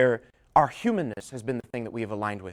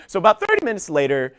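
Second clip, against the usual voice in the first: ordinary talk, nothing marked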